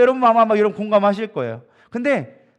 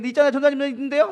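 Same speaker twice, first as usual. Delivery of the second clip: put-on fast, higher child's babble; child chatter; 7 semitones higher, 3 dB louder, faster than usual. Preschooler chattering to the evangelist, fast and high. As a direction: rattle it off like an eager little kid.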